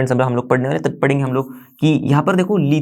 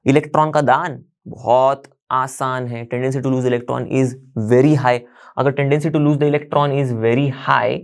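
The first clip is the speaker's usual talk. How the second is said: very slow, word by word; slow emphatic explanation; 2 semitones lower, much slower than usual. Shown as a demonstration. Teacher explaining electron loss to class, very slow and deliberate. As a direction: spell out the idea slowly for students. Teacher stressing key idea slowly.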